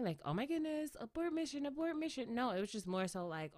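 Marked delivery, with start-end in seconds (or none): 0.2-2.3 mock panic. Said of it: "not necessarily panicking".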